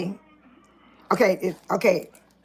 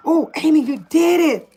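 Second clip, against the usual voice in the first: imagined joy; 4 semitones higher than usual. Pretend. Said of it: "every time y'all see me up dancing".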